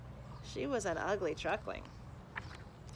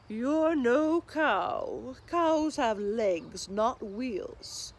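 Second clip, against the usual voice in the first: slow, louder drawn-out cow voice; plodding cow; 2 semitones higher, 7 dB louder, much slower than usual. Acted. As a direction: moo it out like a big lazy cow. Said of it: "said the cow".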